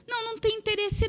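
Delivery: high, sing-out dismissal; acted rejection. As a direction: imitate a breezy refusal. Retells executive's brisk rejection.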